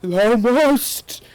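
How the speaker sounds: high, loud, slow evil voice; villain yell. A narrator's reading. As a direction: boom out a dark lord's command. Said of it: "he yelled".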